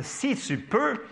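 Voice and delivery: high, very drawn-out questioning rise; incredulous echo. Acted. Jesus questioning the father's doubt, incredulous. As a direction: throw it back as a stretched question.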